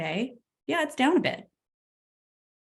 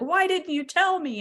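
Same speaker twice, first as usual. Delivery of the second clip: husband's alarm; 9 semitones higher than usual. Retold or imitated. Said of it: "he's like".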